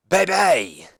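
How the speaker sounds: drawn-out, high BAY-BEE; showy promo sign-off; wrestler's catchphrase. Acted. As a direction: stretch it out high for the crowd. Jericho's catchphrase closing the challenge.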